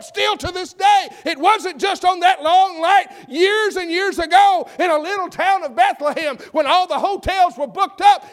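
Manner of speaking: loud, high-pitched pulpit cry; full-force preaching. Acted. Preacher decrying a world with no room for Jesus, full-force preaching. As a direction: build to a shouted, driving sermon peak.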